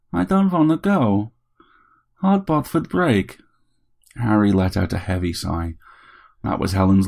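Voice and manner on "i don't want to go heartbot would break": put-on high, plaintive accented robot voice; pleading little robot. A narrator's reading. Bot begs Harry not to send it back, pleading. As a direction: beg to stay, high and drawn out.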